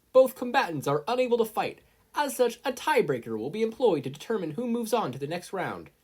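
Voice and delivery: high, loud referee announcement; announcer voice. A narrator's reading. Referee calls the result to the crowd, high and loud.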